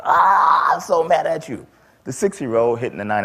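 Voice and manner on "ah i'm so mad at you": put-on high, loud kid's battle cry; a small boy pounding the bully, shrieking high and loud; furious child yell. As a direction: scream it like a raging six-year-old. Six-year-old attacking bully in fury.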